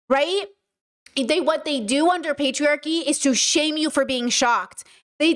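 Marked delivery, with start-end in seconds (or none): none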